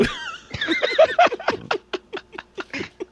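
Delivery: long, high-pitched laughing fit; bursting laughter. Real, not acted. Storyteller's friends hear the embarrassing confession, bursting laughter. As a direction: crack up in high peals of laughter. Group cracks up at punchline.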